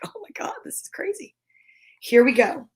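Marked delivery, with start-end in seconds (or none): 0.1-1.4 high whispered panic. Retold or imitated. Bird in the picture panics in a high whisper. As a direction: squeak it out, higher and hushed. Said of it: "He's like".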